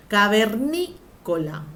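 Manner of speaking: "Cavernícola" slowed, with NÍ held; stressed syllable shown. Shown as a demonstration. Teacher breaking down an example for students, slow "caver-NÍ-cola". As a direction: lean hard on NÍ in "Cavernícola", drawing it out.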